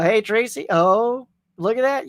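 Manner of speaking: ordinary talk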